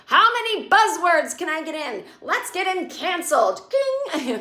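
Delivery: high-pitched mocking writer voice; exaggerated high caricature, ending in a typewriter ding; Michael Patrick King. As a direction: lampoon a hack writer at his typewriter. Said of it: "you can just see Michael Patrick King there".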